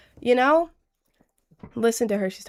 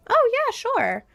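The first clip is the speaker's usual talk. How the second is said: cheerful agreeing; 12 semitones higher, slower than usual. Acted.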